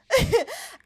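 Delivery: squealing high laugh; high giggle. Real, not acted. Laughing recalling the offer.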